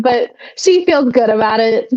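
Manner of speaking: ordinary talk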